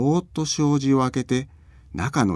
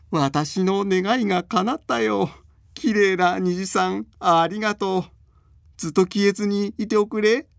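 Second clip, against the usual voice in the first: put-on overjoyed old man; 10 semitones higher than usual. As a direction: thank it like a grateful grandpa. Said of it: "大喜びのおじいさんの声".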